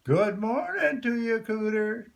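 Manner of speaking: high, loud call to the pond. A narrator's reading. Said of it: "a bit louder this time".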